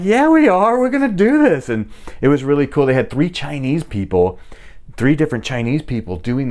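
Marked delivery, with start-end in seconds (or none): 0.0-1.7 playful insistence. Retold or imitated. Insisting back to wife.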